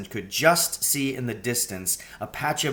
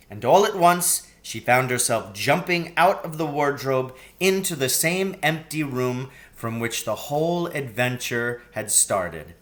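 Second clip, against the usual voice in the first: usual speaking voice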